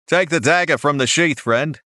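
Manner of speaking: a friendly Australian market seller; Australian accent. A narrator's reading. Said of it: "He had an Australian accent".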